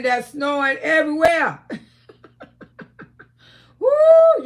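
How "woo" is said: loud high whoop into teasing shout. Real, not acted. Calling out a viewer about her husband, loud whoop. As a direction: whoop loudly then tease.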